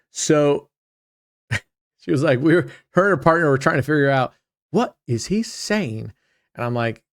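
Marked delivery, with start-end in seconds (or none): 4.7-6.1 mock bafflement, slow and higher; stretched-out puzzled question, pitch jumping up; baffled. Member and partner trying to figure him out, mock baffled.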